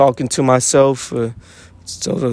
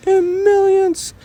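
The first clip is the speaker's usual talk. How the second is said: sudden squeak, much higher and slow; squeaky high voice; slower than usual. Real, not acted. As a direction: jump way up into a squeak. Repeats millions with a squeak.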